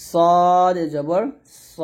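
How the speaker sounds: সদ stretched long and loud; long সদ, heavy "saw" for স; careful model recitation. Shown as a demonstration. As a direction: intone সদ slowly, land on rounded স. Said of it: "উচ্চারণগুলো দেওয়া সুন্দর করে".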